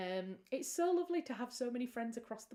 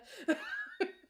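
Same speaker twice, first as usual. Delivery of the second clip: sudden high laugh; 12 semitones higher, 6 dB louder than usual. Real, not acted. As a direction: burst of high giggling. Laughing at the Doctor Pepper.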